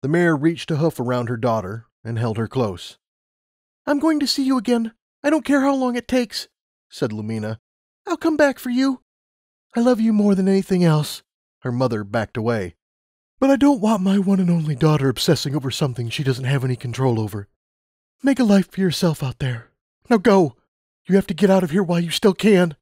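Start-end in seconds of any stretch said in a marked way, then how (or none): 3.9-9.0 put-on much higher girl's voice; a determined promise; young Lumina vowing. Lumina promising her mother she'll return, high young voice. 9.7-22.8 farewell that turns to urging; loving, firm mother. Acted. Mother saying goodbye and sending Lumina off, urgent.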